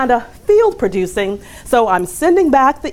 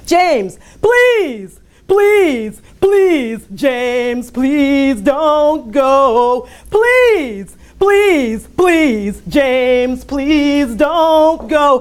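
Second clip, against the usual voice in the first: [name] plea; 4 semitones higher, much slower than usual. Retold or imitated. Coworker imitating [name] begging departing James to stay. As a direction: beg like a soul singer on his knees. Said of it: "in the words of another famous James".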